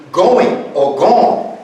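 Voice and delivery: drawn out, each word slowly; slow demo. Shown as a demonstration. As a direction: stretch it out like a teaching example. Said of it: "Be gone is really two verbs".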